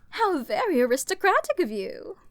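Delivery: loud, fast sarcastic exclamation; mock them with glee. Mocking exclamation.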